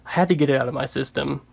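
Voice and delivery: usual speaking voice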